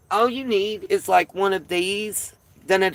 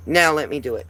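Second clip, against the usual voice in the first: ordinary talk, nothing marked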